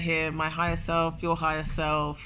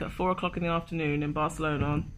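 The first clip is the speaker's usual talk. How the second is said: ordinary delivery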